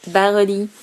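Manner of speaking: mock English accent. Shown as a demonstration. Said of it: "avec mon super accent".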